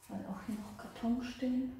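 ordinary delivery

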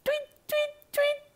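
very high, soft chirped tweets; bird tweets. Acted. Bird flies past.

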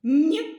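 loud, clear «н» sound; isolated sound. Shown as a demonstration. Teacher to first-graders, sounding out «н» loudly. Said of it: "Перший звук".

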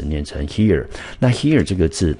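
ordinary talk, nothing marked

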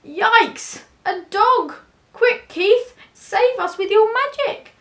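high, loud alarmed plea; panicked squeal. A narrator's reading. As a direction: squeal for help. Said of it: "the cats squealed in panic".

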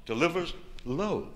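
ordinary delivery, nothing marked

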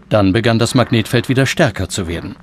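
usual speaking voice

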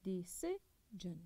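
Decision said slowly with the voice rising on ci; stress on ci. Shown as a demonstration. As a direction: lift the voice on ci in Decision. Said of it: "وينزل مرة ثانية".

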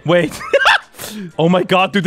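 ordinary delivery

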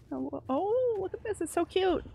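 delighted squeal; cooing delight. Real, not acted. Finds cute creature.

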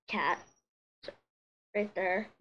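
talking while holding back hiccups. Real, not acted. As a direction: hiccup catching the words. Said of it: "Sorry, I have hiccups".

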